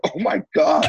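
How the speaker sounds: high-pitched horrified reaction; hammed-up panic, pitch shooting up; mock alarm. Handcuffed beside a confessed murderer, mock horrified.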